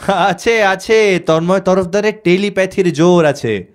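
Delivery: excited outburst, opening high; sudden exclamation. Acted. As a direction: blurt it out like a sudden realisation. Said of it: "ও হঠাৎ করে বলে উঠল". (হঠাৎ উত্তেজিত হয়ে বলে ওঠা)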